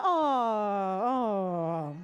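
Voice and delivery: long, low, falling "aww"; touched "aww". Real, not acted. Host hearing of sisters in the show, touched falling aww. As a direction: sigh out a drawn-out, melting aww.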